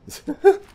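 sudden high, loud laugh; loud burst of laughter. Real, not acted. Reacting to game moment.

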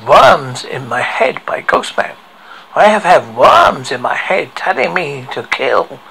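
ordinary delivery

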